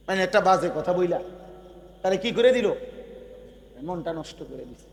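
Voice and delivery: normal talking voice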